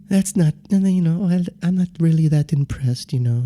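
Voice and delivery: put-on meek, unhurried voice; Joe Haas. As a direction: imitate a mild man politely unimpressed. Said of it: "very quiet and passive in the way he talks".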